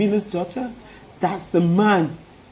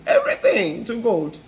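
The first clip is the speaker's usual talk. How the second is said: ordinary delivery, nothing marked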